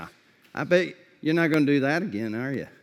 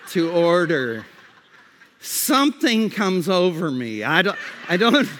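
ordinary talk

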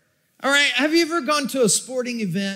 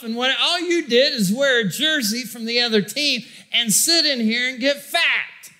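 ordinary talk, nothing marked

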